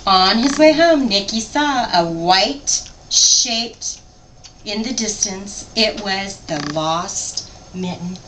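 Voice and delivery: lively picture-book read-aloud; story narration. A narrator's reading. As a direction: read it to a child, animated.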